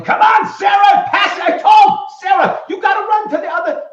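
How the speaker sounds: put-on high, loud sideline shout at a kid; sideline parent yelling. Soccer parent shouting orders at his daughter from the sideline, loud high yell. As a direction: bellow instructions across the soccer field. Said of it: "too many parents yelling".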